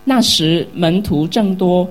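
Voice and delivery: scripture reading. Acted. Pastor reading Acts chapter six aloud to the congregation, loud solemn reading. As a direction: proclaim the verses to the whole church.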